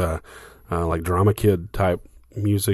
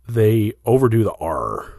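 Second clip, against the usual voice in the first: ordinary delivery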